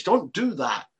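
ordinary delivery

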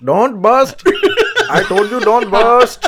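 mock Indian accent. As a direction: scold in a hammed-up Indian accent. Friend mocks the accent bit.

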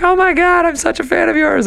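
very high-pitched gushing fangirl squeal; high, hammed-up excited shriek; mock fan scream. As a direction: imitate a starstruck fan losing her mind. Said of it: "she was, like, screaming".

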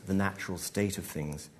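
plain reading voice

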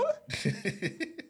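squeaky high laughter. Real, not acted.

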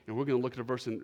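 ordinary talk

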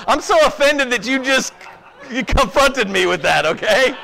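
playful mock offense with laughter; laughing mock offense. Real, not acted. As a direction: pretend to be hurt while laughing.

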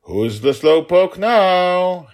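high, loud, slow gloating yell; playful yell. Acted. Father yelling down at daughter, slow high gloat. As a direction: drag it out as you win. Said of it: "he yelled down".

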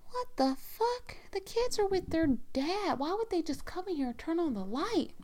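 high-pitched, puzzled disbelief without raising volume; retold bewilderment. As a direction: act out baffled annoyance in a high voice. Retold bewilderment at light coming on.

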